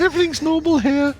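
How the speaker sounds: squeaky fake innocent voice. GM joins the fake voice.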